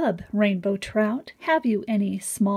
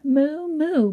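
mock cow moo; 6 semitones higher, 4 dB louder than usual. Reading animal sounds to children.

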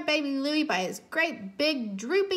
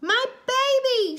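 alarmed cry; 12 semitones higher, 5 dB louder than usual. A narrator's reading. Mommy races to her baby, crying out in alarm. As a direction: cry out for your child in alarm. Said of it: "she cried".

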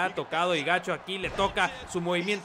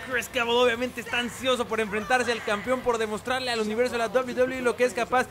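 announcer intro; 6 semitones higher than usual. Acted. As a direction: introduce the champion boldly. Hyped match introduction.

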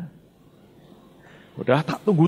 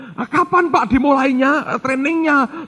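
eager questions; 11 semitones higher, slower than usual. Retold or imitated. Former student pestering the pastor about training, eagerly. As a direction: ask like an eager student who can't wait.

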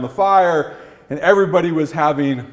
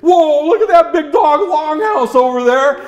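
high-voiced amazement; mock awe, pitched high; admiring onlooker; 12 semitones higher, 4 dB louder than usual. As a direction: exclaim at a wondrous sight. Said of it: "everybody will say".

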